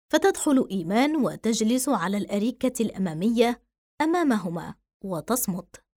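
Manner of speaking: plain reading voice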